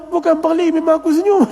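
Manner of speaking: high, insistent mock protest; retold protest. Pupil defends his smile to friends, high-pitched retold protest.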